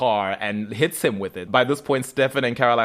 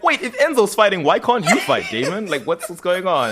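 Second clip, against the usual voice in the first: mock alarmed Stefan; 7 semitones higher, 4 dB louder than usual. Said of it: "And then Stefan's like".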